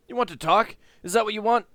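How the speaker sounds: high, loud scornful retort; angry old recluse. Acted. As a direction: throw the question back in anger. Voices bitter Sandoz.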